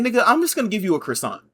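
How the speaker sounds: high, loud and quick exasperation; aggressive. Retold or imitated. Barista imagining telling off the drive-thru customer, aggressive. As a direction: fire it off fast, high and forceful. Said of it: "I wanted to speak that aggressively towards her".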